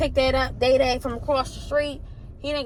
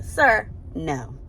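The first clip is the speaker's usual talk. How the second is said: ordinary delivery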